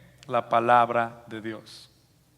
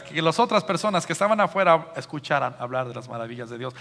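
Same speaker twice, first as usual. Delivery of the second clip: normal talking voice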